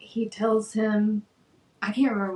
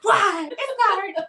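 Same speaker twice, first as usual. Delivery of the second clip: much higher and louder, indignant; outraged protest; 12 semitones higher, 6 dB louder, slower than usual. Retold or imitated. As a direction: protest the accusation at full pitch. Voicing Mikey's outraged protest.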